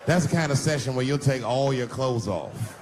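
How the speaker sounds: ordinary talk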